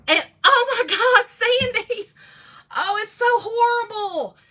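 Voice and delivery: high, loud laughing exclaim; giggly oh my God. Real, not acted. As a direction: laugh out the horror of it. Laughing about the horrible moment.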